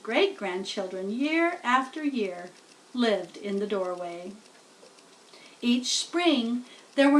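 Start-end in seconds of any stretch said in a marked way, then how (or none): none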